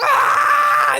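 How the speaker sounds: high loud bug scream. Pretend. Bug being wiped off the windshield, loud scream.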